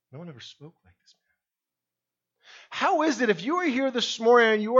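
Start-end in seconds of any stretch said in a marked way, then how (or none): 0.1-2.5 hushed. Acted. Preacher repeats line very softly.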